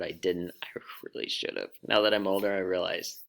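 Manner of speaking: ordinary talk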